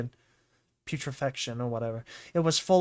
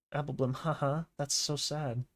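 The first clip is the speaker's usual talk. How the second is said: ordinary delivery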